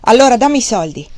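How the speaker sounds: loud harsh demand. A narrator's reading. Wang Lung demanding gold from the fat man, harsh. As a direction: demand the money roughly. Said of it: "con una voce aspra".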